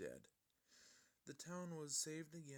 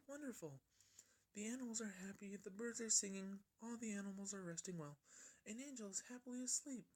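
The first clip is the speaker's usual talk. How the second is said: high, gentle and soft-spoken; a high, timid pony voice; soft Fluttershy voice; 8 semitones higher than usual. A narrator's reading. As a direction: speak softly like a shy pony. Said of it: "She then said quietly".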